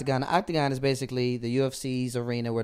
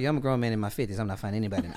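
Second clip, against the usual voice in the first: low, weary-sounding imitation of Stephen A; real low voice; 6 semitones lower, 4 dB quieter, much faster than usual. Retelling Stephen A refusing the octagon fight, low voice. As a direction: drop the pitch and play it down. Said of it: "in that real low voice".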